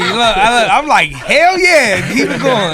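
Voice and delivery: loud, high hyped-up shout; excited hype. Real, not acted. [name] hyping up Tiger's success, loud and high excitement. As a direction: cheer him on like a hype man.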